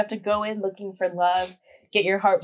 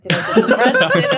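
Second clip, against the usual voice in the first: laughing, louder, a mock alarmed question; laughing panic; 9 dB louder than usual. Recalls fearing an arrest, laughing loudly. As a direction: laugh through a fake panic. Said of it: "I was like".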